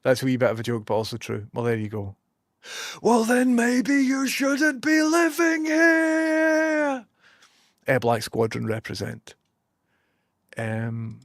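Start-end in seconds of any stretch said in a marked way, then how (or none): 3.0-7.1 very high, drawn-out whine, last word held long; mocking retort voice. Streamer mimicking a critic of her joke, high mocking whine.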